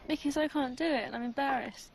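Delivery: high-pitched tearful confession; real upset, embarrassed and crying. Real, not acted. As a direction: explain yourself through tears. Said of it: "[name], niye ağladın?".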